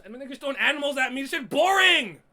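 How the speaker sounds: loud, high, fast, fed-up rant; exasperated shout. Real, not acted. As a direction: yell it out, bored to death. Fed up with a boring fight.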